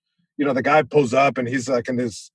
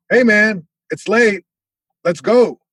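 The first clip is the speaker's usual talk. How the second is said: lightly voiced dad, pitched higher; a hint of dad's raised voice; dad impression; 5 semitones higher, 3 dB louder, slower than usual. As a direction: call out as an impatient dad collecting his kid. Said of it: "impression of your dad".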